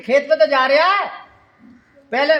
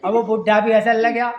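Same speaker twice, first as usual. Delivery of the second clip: normal talking voice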